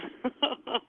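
high amused chuckle; laugh. Real, not acted. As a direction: laugh lightly in high bursts. Guest amused by book idea.

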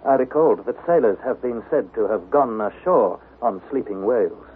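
normal talking voice

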